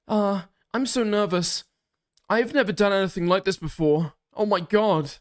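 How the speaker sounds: high-pitched nervous quote; mock nervous texter. Streamer mimicking a nervous text, high mock-anxious voice.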